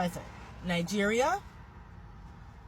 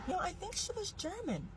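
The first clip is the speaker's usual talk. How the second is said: high, softer mom impression; imitating mom; 10 semitones higher, 9 dB quieter than usual. Mom explaining great grandma, a high, softer imitation. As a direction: lightly play the mom brushing it off. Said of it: "she was like".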